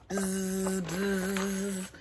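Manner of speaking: soft low buzzing; imitating bees for the kids. Shown as a demonstration. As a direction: buzz like a bee.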